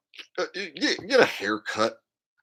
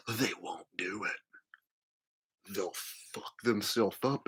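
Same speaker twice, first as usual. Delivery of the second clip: whispered aside; 3 semitones lower, 9 dB quieter, much slower than usual. Real, not acted. Quiet aside.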